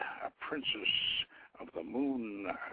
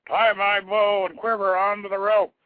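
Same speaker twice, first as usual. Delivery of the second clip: shouted up to companions below, much louder and higher; 9 semitones higher, 10 dB louder than usual. A narrator's reading. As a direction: call it down the tower hole at full voice. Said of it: "he shouted to his companions below".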